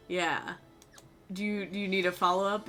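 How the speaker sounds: normal talking voice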